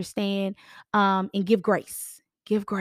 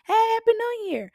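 high, slow sing-song greeting; cheery greeting; 12 semitones higher, slower than usual. Retold or imitated. Realtor greeting clients at their door, high and slow.

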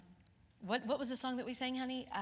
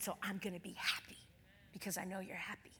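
soft tender whisper to her father; 2 semitones lower, 6 dB quieter than usual. Retold or imitated. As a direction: hush it gently, like a bedside promise. Tender words to dying father.

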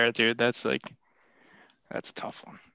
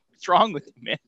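normal talking voice

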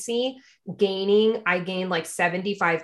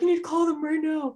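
pitched-up frantic demand; high desperate plea; 8 semitones higher than usual. Retold or imitated. Woman in labor to husband, high frantic demand. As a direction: beg him to call right away. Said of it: "And I'm like".

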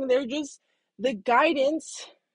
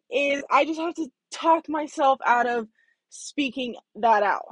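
ordinary talk, nothing marked

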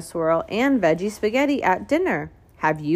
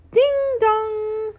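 doorbell chime; 13 semitones higher than usual. A narrator's reading. Doorbell sound in story.

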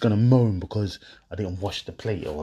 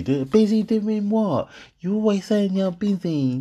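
a lightly voiced annoyed girlfriend; mock girlfriend; 9 semitones higher than usual. Girlfriend snapping at her man over the dishes, higher mock scold.

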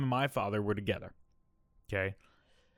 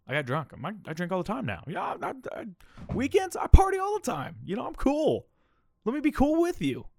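much higher, whiny kid voice playing it cool; mock teen bragging; 12 semitones higher, 4 dB louder than usual. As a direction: imitate your eager teenage self begging to be let in. Younger self begging brother for drinks.

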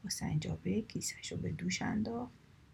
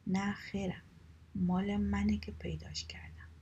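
ordinary delivery, nothing marked

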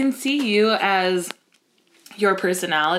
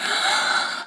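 sharp voiceless mock gasp; horrified gasp. Realizing she hurt a friend, an acted gasp.

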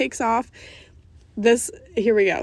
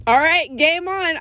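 higher, louder burst of determination; psyched up; 8 semitones higher, 6 dB louder than usual. Retold or imitated. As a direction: fire yourself up for a race. Accepting the challenge to run.

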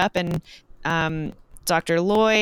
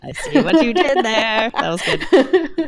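laughing; 4 semitones higher, 5 dB louder, slower than usual. Real, not acted. Laughing at a pun.

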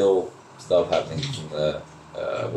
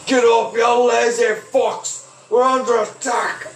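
a hobgoblin yelling to wake the camp, alarmed; 13 semitones higher than usual. Acted. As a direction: bellow it to rouse the camp. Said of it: "yells out and goes".

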